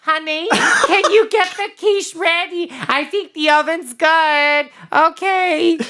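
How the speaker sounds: very high, slow falsetto mom; hammed-up falsetto homemaker; housewife mom. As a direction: coo to honey in an exaggerated high housewife voice. Plays the mom calling from kitchen.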